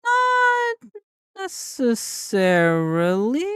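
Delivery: squeaky high drawn-out not. Real, not acted. Playful disagreement, drawn out.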